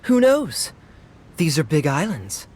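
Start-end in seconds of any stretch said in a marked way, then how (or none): 0.0-0.7 teasing. Acted. Playful teasing after laugh.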